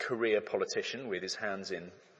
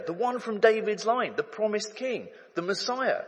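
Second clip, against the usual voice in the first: building excitement; 7 semitones higher, 4 dB louder than usual. Acted. Israel's long-awaited king arrives.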